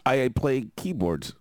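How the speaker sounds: normal talking voice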